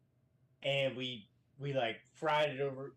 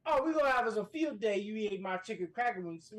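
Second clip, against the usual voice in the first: angry Southern threat; 6 semitones higher, 4 dB louder than usual. Retold or imitated. Team leader threatening the soldier with push-ups, angry Southern voice. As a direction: promise punishment like a furious drill leader. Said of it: "but he was so pissed".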